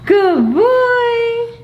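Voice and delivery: high, slow, sing-song praise for a dog; pet praise. Real, not acted. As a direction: coo it up high like praising a puppy.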